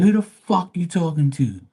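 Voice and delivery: high, heated mock retort; defiant comeback. Speaker retorts to customer.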